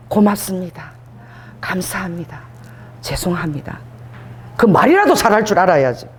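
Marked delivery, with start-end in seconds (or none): 0.1-3.8 low, measured, humble phrases; subdued thanks and apology. Shown as a demonstration. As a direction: model each phrase low, with pauses for repeating.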